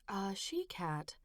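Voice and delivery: slow whispered musing; trailing whisper. Acted. Mother pondering the phrase, trailing whisper. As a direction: let it fade to a whisper. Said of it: "Her voice trailed off".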